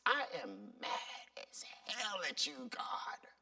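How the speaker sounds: hushed whisper, angry words kept secret; whispered confession. Shown as a demonstration. Praying honestly to God, whispering mad. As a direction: breathe the anger out in a whisper.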